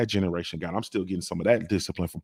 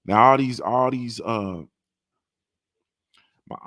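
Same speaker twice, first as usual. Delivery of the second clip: usual speaking voice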